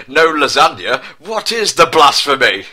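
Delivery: offended Garfield; mock outrage. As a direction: huff about the missing lasagna. Indignant outburst.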